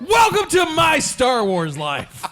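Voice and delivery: slow booming ring announcer call; wrestling promo. Pretend. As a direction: stretch it out like a wrestling hype man. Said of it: "you're like a wrestling promo guy".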